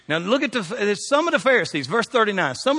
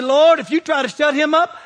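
high, loud, fervent shout; preacher's shout; 11 semitones higher, 7 dB louder than usual. Retold or imitated. Preacher telling of a man who can't be silenced, fervent shout. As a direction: cry out to the Lord in a retold reply. Retelling his own fervent reply.

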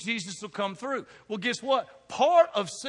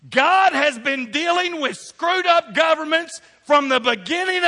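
higher, louder, slow and building; full-force preaching; 7 semitones higher, 7 dB louder than usual. Acted. Pastor tells the church God comes through, full-force preaching. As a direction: rise into the closing with hope.

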